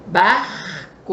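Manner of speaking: Barco with a retroflex, curled caipira R; interior São Paulo R. Shown as a demonstration. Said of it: "É aquele som puxado, né, do interior". ("Barco" com R puxado do interior paulista)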